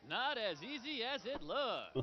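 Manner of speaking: singsong showman patter; bouncing fairground singsong; carnival barker. Acted. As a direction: chant it teasingly to the players. Barker comments on a miss.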